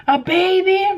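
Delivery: shocked high-pitched question from mom; surprised. Retold or imitated. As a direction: squeak it out, can't believe it. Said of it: "she's like".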